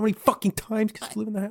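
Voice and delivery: high, fast incredulous rant. Retold or imitated. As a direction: scoff at her choice. Incredulous rant about character.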